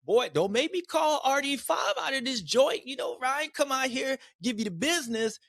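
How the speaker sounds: a high mock voice ribbing his rider; Jay's pit board voice. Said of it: "Jay probably put on that pit board".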